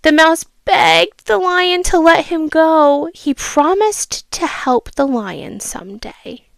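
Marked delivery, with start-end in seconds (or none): none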